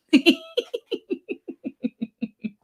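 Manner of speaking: long high giggling fit; helpless squeaky laugh; high wheezy laughter. Real, not acted. Laughs at viewer's comment.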